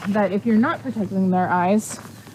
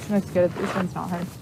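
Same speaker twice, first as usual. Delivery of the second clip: ordinary talk, nothing marked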